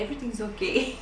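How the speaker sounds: soft, laughing "everything's okay" with a face; fake everything's okay. Wife telling her husband she's fine, a mock-casual laugh. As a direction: pretend nothing is wrong, through a laugh. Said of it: "I'll say".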